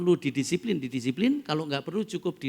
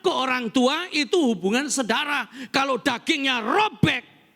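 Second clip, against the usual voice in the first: high, forceful sermon build to loud peaks; full-force preaching; 10 semitones higher, 7 dB louder than usual. Real, not acted. Preacher explains marriage comes before children, full-force preaching. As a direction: hammer each point home to the congregation.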